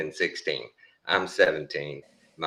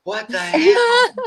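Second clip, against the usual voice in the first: shocked buddy; 7 dB louder, slower than usual. Retold or imitated. Buddy baffled after seeing the fall.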